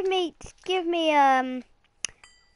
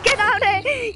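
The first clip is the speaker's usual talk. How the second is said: ordinary delivery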